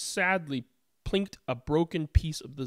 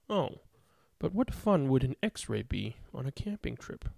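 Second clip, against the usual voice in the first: ordinary delivery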